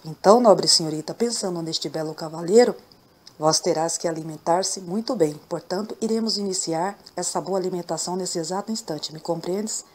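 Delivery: usual speaking voice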